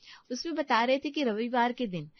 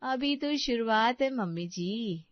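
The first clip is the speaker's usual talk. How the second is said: ordinary talk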